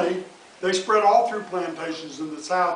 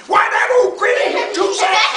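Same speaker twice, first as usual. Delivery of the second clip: much higher, louder fox voice; Brer Fox; 11 dB louder than usual. Acted. Fox complaining to Brer Bear about the rabbit, high shout. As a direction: squeal it out like an indignant fox. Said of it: "says Brer Fox".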